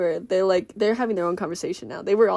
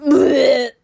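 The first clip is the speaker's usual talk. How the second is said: loud, comic retch; mock retching; 5 semitones higher, 10 dB louder than usual. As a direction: pretend to throw up from nerves. I'm gonna throw up.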